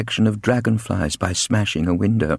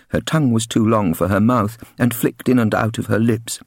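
ordinary delivery

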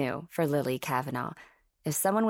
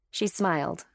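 ordinary delivery

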